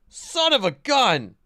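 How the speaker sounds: sudden high, loud exclamation of frustration; surprised outburst. Real, not acted. Streamer yelps as the mic volume jumps, high and loud. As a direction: blurt it out, caught off guard.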